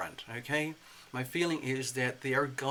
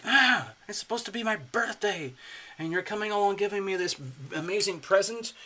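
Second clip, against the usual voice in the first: higher, louder mock delight; mock excitement; 6 semitones higher, 4 dB louder than usual. Libra expecting a birthday gift, higher gushing delight. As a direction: gush like a kid on her birthday. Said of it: "It's almost like".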